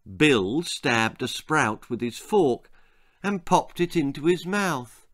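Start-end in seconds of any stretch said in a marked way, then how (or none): none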